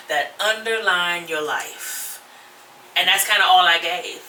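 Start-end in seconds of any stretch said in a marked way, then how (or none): none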